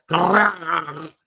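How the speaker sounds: GORILLA slowly drawn out on a rolled R. Shown as a demonstration. Host shows the choking roll, slow exaggerated gorilla. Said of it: "See what a roll".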